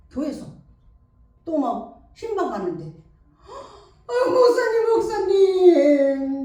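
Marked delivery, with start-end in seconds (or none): 4.1-6.4 high, wailing call to the pastor; a distraught mother sobbing out the pastor's name; tearful plea. Acted. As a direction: cry out to the pastor like a heartbroken mother. Crying mother appeals to pastor.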